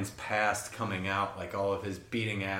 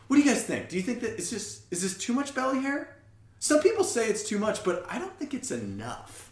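goofy Doug voice; 11 semitones higher than usual. Retold or imitated. Doug showing his belly to the guests, high silly voice. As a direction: ask about your belly hair in a squeaky, guileless voice. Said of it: "just be like".

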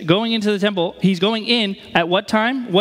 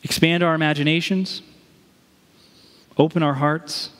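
prayer; 5 semitones lower than usual. Acted. Closing prayer.

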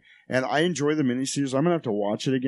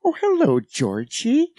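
high loud sing-song greeting; sweetly sinister, luring a child; creepy clown voice; 12 semitones higher, 5 dB louder, much slower than usual. Retold or imitated. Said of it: "he's like".

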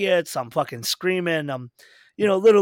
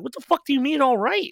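retold indignant challenge; 10 semitones higher than usual. Storyteller retelling his challenge to the sulking musician, high incredulous outrage. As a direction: throw his words back, pitch way up.

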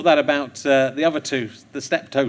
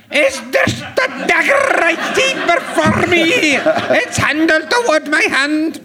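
slow, high, half-shouted Macbeth in a put-on accent; hammed-up Macbeth in a funny accent; Shakespeare in a funny accent; 10 semitones higher, slower than usual. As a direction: declaim Macbeth like a ham in a silly regional voice. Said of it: "Shakespeare in a funny accent".

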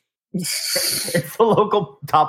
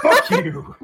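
laughing yell; 5 dB louder than usual. Acted. Jokey angry outburst.

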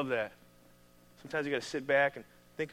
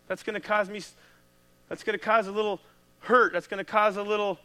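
normal talking voice

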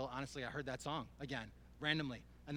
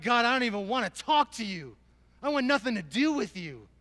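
retold anger; 8 semitones higher, 12 dB louder than usual. Retold angry refusal to God.

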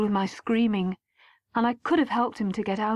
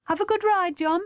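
mum calling up the stairs, voiced high and light; a cheerful call to the son coming in, not a full shout; lightly called out; 9 semitones higher than usual. A narrator's reading. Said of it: "Mum shouted".

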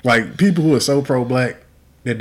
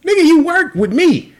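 much higher and louder, mocking; mock heated rant; 13 semitones higher, 3 dB louder than usual. Mocking a hypocritical coworker in a loud high put-on voice.

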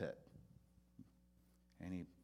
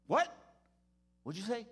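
surprised pastor; 12 semitones higher, 9 dB louder than usual. Retold or imitated. Pastor surprised at boy's news.

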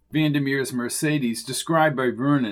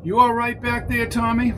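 higher, friendly calling back; higher character voice; 10 semitones higher than usual. A narrator's reading. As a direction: call back to the trunk. Vernon voiced in story.

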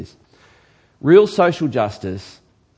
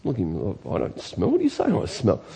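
mock protesting listener; 4 semitones lower, 6 dB quieter than usual. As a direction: play an affronted churchgoer in mock protest. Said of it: "Do you know what you smell like?".

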